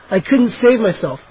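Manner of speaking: plain reading voice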